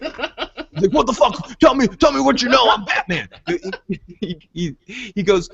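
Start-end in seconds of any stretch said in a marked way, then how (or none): 0.9-3.3 mock Batman. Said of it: "He just goes, he's like".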